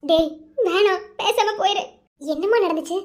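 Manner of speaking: normal talking voice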